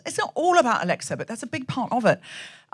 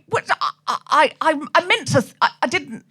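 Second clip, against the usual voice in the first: broken false starts in mock confusion; flustered stammering; 5 semitones higher than usual. Speaker realising they misread the speech, mock flustered stammer. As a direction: stumble and restart as if caught out. Acts flustered after a slip.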